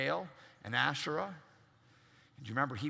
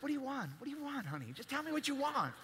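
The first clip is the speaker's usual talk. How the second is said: soft doting dad; 9 semitones higher, 5 dB quieter, faster than usual. Retold or imitated. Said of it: "I was like".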